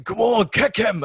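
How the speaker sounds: high, loud slow sideline yell; shouting Scottish dad. Retold or imitated. Dad yelling at his son's football match, mock shout. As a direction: bellow it from the touchline. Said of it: "So he's like".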